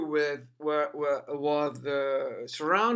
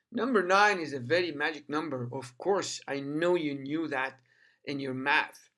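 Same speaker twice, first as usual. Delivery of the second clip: normal talking voice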